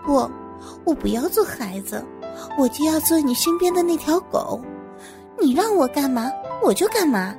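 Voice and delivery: curry favour with the boss; ingratiating. Acted. Employee pledges obedience to the boss, ingratiating. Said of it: "讨他欢心地说".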